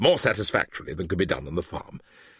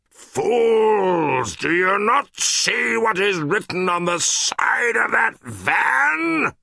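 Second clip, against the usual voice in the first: high, loud, slower furious rebuke; angry shouting; 10 semitones higher, 4 dB louder, much slower than usual. Acted. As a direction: scold the blind crowd. Benjamin rebukes the animals.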